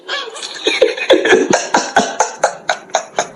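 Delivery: high, airless giggling laugh; breathy giggle. Real, not acted. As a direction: burst into a silent, high giggle. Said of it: "Why are you laughing?".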